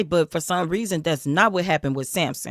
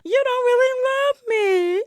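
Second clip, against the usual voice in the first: high, slow hammed-up sob; mock sobbing; slower than usual. Wife sobbing at Samson to get the answer, hammed-up cry. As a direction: wail it like a fake cry. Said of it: "she was crying".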